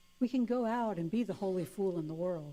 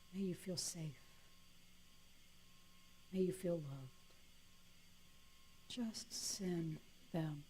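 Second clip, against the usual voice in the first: softer, slow meditation voice; guided meditation; 10 dB quieter than usual. Acted. Leading the loving kindness meditation, soft and slow. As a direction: guide them gently into kindness.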